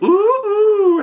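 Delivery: very high mocking "ooh, ooh"; sing-song taunt of a friend; teasing. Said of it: "teases him, and he's like".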